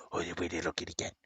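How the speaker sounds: quick whispered coo to the kitty. Real, not acted. Owner whispering to his cat, soft and quick.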